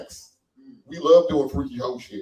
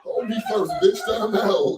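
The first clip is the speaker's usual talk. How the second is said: higher, loud mock whimpering plea; overwhelmed lover; 6 semitones higher, 3 dB louder, slower than usual. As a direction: plead through laughter for a break. Acting overwhelmed lover begging pause.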